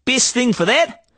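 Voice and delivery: hammed-up Aussie salesman twang; broad Australian accent. Street trader hawking junk in a broad Australian accent. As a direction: lay on the outback Australian accent thick. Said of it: "we only talk like that".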